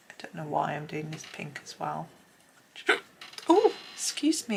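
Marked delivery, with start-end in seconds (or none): 3.5-4.6 hiccup breaks the words. Real, not acted. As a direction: caught by a sudden hiccup.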